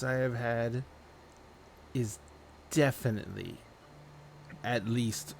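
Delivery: put-on embarrassed but measured young woman; flustered young lady wagering. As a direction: counter the wager haltingly, choosing each word.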